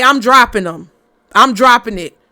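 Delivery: loud, firm declaration; loud comment. Shown as a demonstration. Reader telling viewers what to post, said loud and firm.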